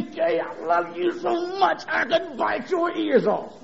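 high, slow, strained voice imitating a hug; acted-out squeezing a little child; doting squeeze voice. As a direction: gush softly through a tight squeeze. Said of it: "And I would squeeze her".

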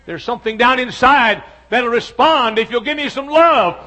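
loud, high, driving pulpit voice; full-force preaching. Acted. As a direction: hammer each line home to the congregation.

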